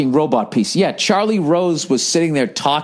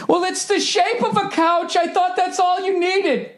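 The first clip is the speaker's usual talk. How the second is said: much higher, dopey mocking voice; mock couch maker. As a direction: play the clueless furniture designer, high and silly.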